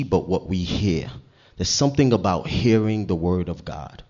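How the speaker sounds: usual speaking voice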